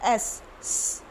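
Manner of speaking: स as an unvoiced hiss; just hiss स without the vowel. Shown as a demonstration. Teacher showing kids the hissing S sound. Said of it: "स नहीं बोलेंगे".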